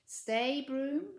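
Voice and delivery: loud sharp command to the broom; shrieking. A narrator's reading. Maud shrieking at her broom to stop, loud and urgent. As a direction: shriek it in panic. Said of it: "shrieked Maud".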